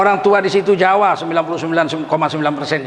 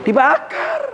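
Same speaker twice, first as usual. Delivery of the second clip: shouted dibakar; slower than usual. Shown as a demonstration. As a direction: fling out "Dibakar" in a high shout.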